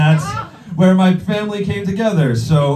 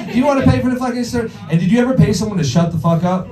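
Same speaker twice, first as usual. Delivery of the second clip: higher, very fast frantic rant; paranoid mom motor mouthing; 6 semitones higher, much faster than usual. Retold or imitated. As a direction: rattle it off like a panicked mother. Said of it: "motor mouthing".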